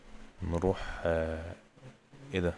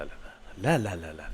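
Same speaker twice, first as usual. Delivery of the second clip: ordinary talk, nothing marked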